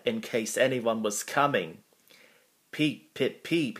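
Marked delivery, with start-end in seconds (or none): 2.7-3.8 high little engine whistle peeps; short peeps pitched up like a whistle. A narrator's reading. Thomas warns people on the line, high whistled peeps. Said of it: "he always whistled".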